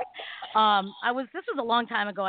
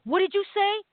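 mock tough guy demanding, higher and louder; retold threat; 9 semitones higher, 4 dB louder than usual. Troublemaker squaring up to the friend, a mock menacing challenge. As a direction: toss it back like a street thug squaring up. Thug confronts friend on street.

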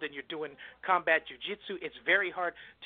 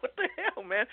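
pitched well up, retelling a friend's disbelief; a lightly imitated "what the hell" from an old friend; mock disbelief; 6 semitones higher than usual. Old friend meeting him at the Galleria, high mock disbelief. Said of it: "You're like".

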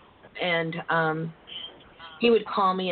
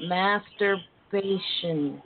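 word said very slowly; drawn out; much slower than usual. Shown as a demonstration. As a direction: stretch every syllable slowly. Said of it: "Y'all all need to say that together".

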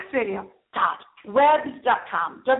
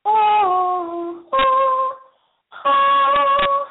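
rising sung oh's, each higher and louder; dramatic oh; 6 dB louder, much slower than usual. Pretend. Host hams up excitement over Count Dracula with rising oh's. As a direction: wail out three rising ohs. Dracula exclamation, high sustained.

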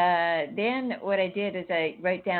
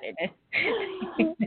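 laughing; 13 semitones higher than usual. Real, not acted. Admits her wish was sad.

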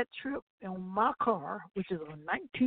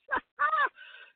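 high laugh. Real, not acted. Laughing at purse joke.

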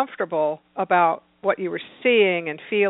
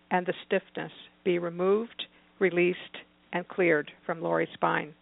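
read-aloud prayer; 4 dB quieter than usual. Retold or imitated. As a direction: read it gently as a prayer, letting it sink softer.

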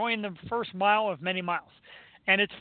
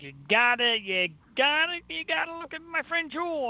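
lightly voiced eager friend, pitch climbing higher; a hint of a pushy friend's pitch; imitating Chrissy; 7 semitones higher than usual. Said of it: "She's like".

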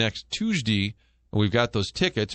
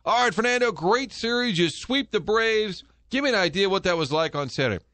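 much higher, pumped-up host voice; overeager interviewer; 7 semitones higher than usual. Retold or imitated. Host retelling how he overcoached a player on air, pumped up. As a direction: oversell every question to the guest. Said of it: "I'm like".

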